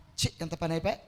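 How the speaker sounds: low, soft muttered self-reproach; regretful devil. Acted. Devil rues losing heaven, low muttered regret. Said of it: "మొదటి కోపం".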